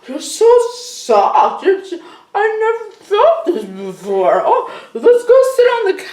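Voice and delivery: giddy high-pitched stoner awe; stoned and blissed out. Acted. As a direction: marvel at everything like you're high. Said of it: "They're very high".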